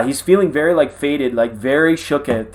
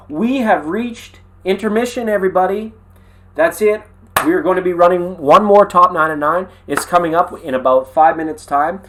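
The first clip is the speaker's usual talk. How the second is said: upbeat announcer voice, higher; bright show-host announcement; 4 semitones higher, 3 dB louder than usual. Acted. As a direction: announce the intermission to everybody. Announcer style intermission.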